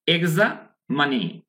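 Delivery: এক্সামানি split as "egza, manee"; slow, pause midway through এক্সামানি; syllable-split pronunciation. Shown as a demonstration. Teacher answering own quiz for learners, syllable-split pronunciation. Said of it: "তাহলে কি হলো?".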